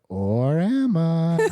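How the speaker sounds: slow, drawn-out teasing question with a swooping rise; teasing reveal. Acted. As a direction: stretch it out like a sly gotcha. Villain-like figure pops out, teasing.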